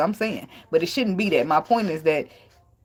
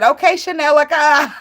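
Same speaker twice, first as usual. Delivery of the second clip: higher, louder, sassy dismissive Okay; Jessica Dime's voice; 7 semitones higher, 6 dB louder than usual. Retold or imitated. Retelling the reality show clapback, sassy and laughing. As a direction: mimic a reality star cutting someone down. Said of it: "She said".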